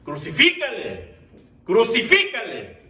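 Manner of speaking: mounting cry for condemnation, rising high and loud; crowd shouting. Retold or imitated. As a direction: shout as the mob demanding a sentence. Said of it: "estaba gritando".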